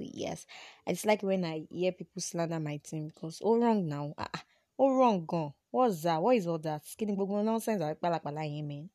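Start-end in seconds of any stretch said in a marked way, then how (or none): none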